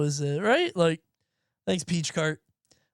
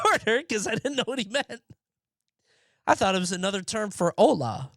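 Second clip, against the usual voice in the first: ordinary talk, nothing marked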